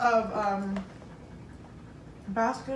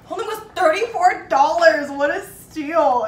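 sarcastic quote; 5 semitones higher, 8 dB louder, slower than usual. Retold or imitated. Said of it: "she goes".

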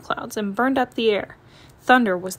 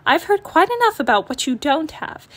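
scolding mother; 6 semitones higher, 4 dB louder than usual. Acted. Mama scolds complaining cubs.

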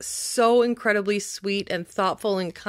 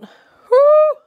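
high, loud whoop of delight; big delighted whoop; excited woo; 11 dB louder than usual. Real, not acted. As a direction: cheer at the new leaf. Admiring a big new leaf.

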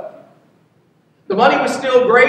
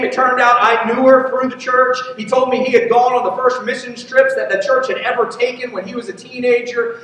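ordinary talk, nothing marked

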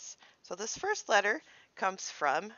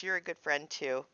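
normal talking voice

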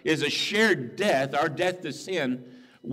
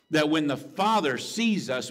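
ordinary talk, nothing marked

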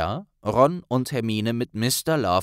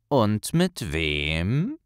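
slow, drawn-out sly question; 2 semitones lower, much slower than usual. Acted. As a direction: lean in and tease him. Ron geheimnistuerisch vorgebeugt. (langsam, neugierig-verschwörerisch)

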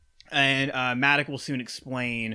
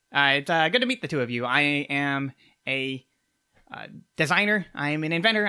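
higher-pitched, halting gnome voice full of uhs; a jittery little tinkerer stumbling over his name; nervous gnome inventor; 3 semitones higher, 3 dB louder than usual. Acted. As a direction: gnome introducing himself to the party, higher and halting. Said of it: "and he goes".

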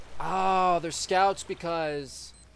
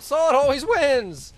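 high, loud, drawn-out victory cry; triumphant scream; 11 semitones higher, 11 dB louder than usual. Acted. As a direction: shout it slowly like a boast. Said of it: "I was about to scream out".

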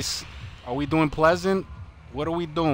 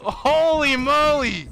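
amazed exclamation; 12 semitones higher, slower than usual. Real, not acted. Watching teammate's crazy play.

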